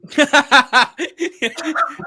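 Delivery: high-pitched real laugh; high giggling fit; laughter. Real, not acted. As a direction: burst into a long, high laugh. Laughing at planned heartbreak.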